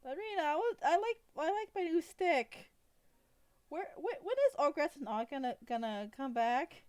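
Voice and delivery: put-on high, eager childlike voice; high-pitched goblin kid, stumbling over words; little goblin girl. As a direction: whine and wonder like a small child. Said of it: "hear the goblin say".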